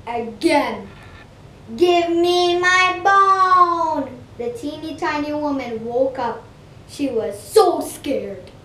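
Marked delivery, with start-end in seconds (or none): 1.7-4.1 slow, louder, higher sung ghost call; ghostly sing-song call. Acted. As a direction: croon it like a haunting ghost. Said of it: "the teeny tiny voice called out again".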